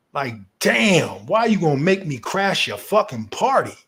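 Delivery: normal talking voice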